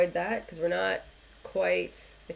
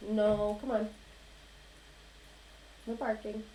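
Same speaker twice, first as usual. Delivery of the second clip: hushing a pet; 3 semitones higher, 5 dB quieter than usual. Real, not acted. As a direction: tell the dog to hush. Talking to the dog.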